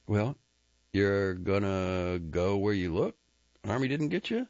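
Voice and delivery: lightly voiced rancher; Ennis questioning. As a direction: probe with curiosity. Ennis asks.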